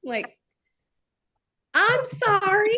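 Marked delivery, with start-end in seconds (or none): 1.7-2.8 high, slow, laughing sorry; mock apology. Answering fans who compare friends to Toga, laughing mock apology. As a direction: fake a guilty apology through laughter.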